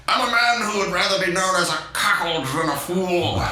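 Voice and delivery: lofty retort from a wounded husband; haughty mock toff voice; proud old husband. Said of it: "Sir Charles is like".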